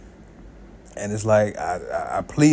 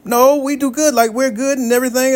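friend insisting; 11 semitones higher, 3 dB louder than usual. Retold or imitated. Friend defending his marriage.

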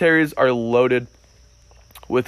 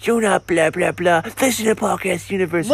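higher, hammed-up Long Island accent; mock Long Island accent, pitched up; 4 semitones higher than usual. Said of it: "in his funny Long Island accent".

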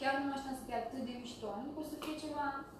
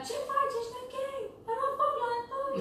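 much higher, anxious mock parent voice; worried parents on the phone; 11 semitones higher, 3 dB louder than usual. Daughter imitating her worried parents, high and anxious. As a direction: play the panicked parent calling about the fire. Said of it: "mă sunau ai mei".

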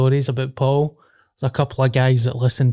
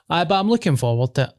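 ordinary delivery

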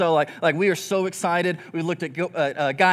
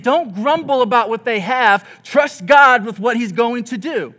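1 firm exhortation; 5 semitones higher, 8 dB louder, slower than usual. Retold or imitated. Preacher voicing James's command.

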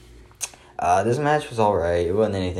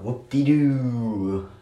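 slow, drawn-out mock cheer; slowly stretched, mock-excited sarcasm; sarcastic whoop-de-doo; 5 semitones higher, 3 dB louder, much slower than usual. Real, not acted. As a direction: drag it out with fake enthusiasm. Sarcastic at announced match.

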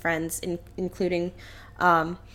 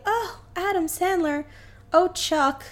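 breathy high swoon of a lady; mock-swoon at Chuck; 8 semitones higher than usual. Basketball lady cooing at Chuck, mock swoon. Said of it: "she's like".

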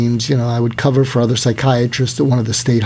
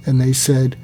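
ordinary talk

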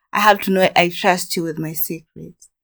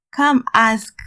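ordinary talk, nothing marked

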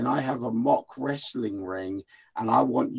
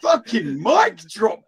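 laughing shout; 8 semitones higher, 9 dB louder, slower than usual. Real, not acted. Reacting to the comeback burn.

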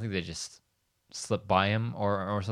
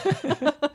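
squeaky high laugh. Real, not acted. Laughing at quote.

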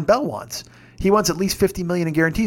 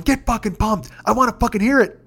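high, fired-up pep shout; rally a friend with a high-pitched holler; retold yelling; 7 semitones higher than usual. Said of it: "'cause I'm yelling".

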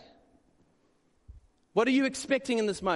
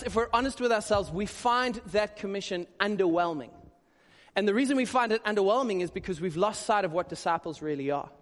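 ordinary delivery

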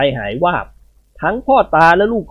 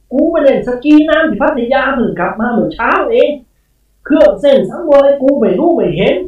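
scolding spirit; 9 semitones higher, 4 dB louder than usual. A narrator's reading. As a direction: lord it over them like an ancient ghost. Spirit lecturing the household. (เจ้าพ่อเทศนาเสียงดัง)

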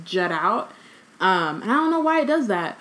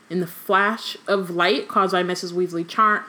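normal talking voice

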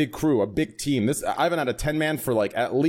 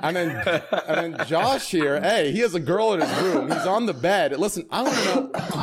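laughing; 5 semitones higher than usual. Real, not acted. Teasing a guest, laughing.

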